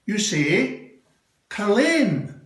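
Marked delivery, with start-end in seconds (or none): 1.5-2.4 Culzean said kul-AYN, the z silent; Scottish place name. Shown as a demonstration. Scottish uncle showing how Culzean is said. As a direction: Culzean as kuh-LANE, stress on the end. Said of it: "here's how you say it".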